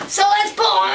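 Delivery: high, loud shout to heave; rallying call. Acted. Storyteller to children before the pull, rallying shout. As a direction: call everyone to pull together.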